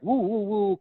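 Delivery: high, sing-song martial arts whoops; mock kung fu cries. Mocking fake movie fighters with high whoops. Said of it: "kung fu-y stuff".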